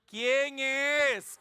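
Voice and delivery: high, loud shout through the door; acted call-out. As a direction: call out to whoever is knocking. Acts answer from inside the house.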